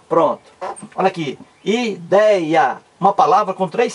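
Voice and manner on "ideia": IDEIA in three separate beats, i, dei, a; measured syllable split of IDEIA. Shown as a demonstration. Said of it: "vamos separar aqui".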